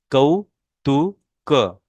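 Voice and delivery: कौतुक drawn out slowly with a falling end; slow model pronunciation. Shown as a demonstration. Teacher models कौतुक slowly for the class. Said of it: "मेरे pronunciation के ऊपर आपने ध्यान देना है".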